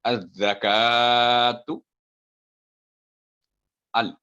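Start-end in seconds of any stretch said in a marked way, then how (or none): 0.0-1.8 अज़्ज़कातु with the का held two counts; long recitation. Shown as a demonstration. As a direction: stretch the का slowly. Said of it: "टू second लंबी होगी".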